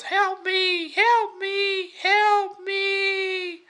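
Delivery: very high, squeaky cries of help me; tiny fly voice. Acted. Tiny fly begging for rescue.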